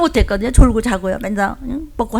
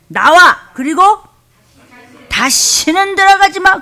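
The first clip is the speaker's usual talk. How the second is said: loud, slow, high command; louder command; 9 semitones higher, 7 dB louder than usual. Shown as a demonstration. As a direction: drive it out with full force. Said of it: "아, 크게 해".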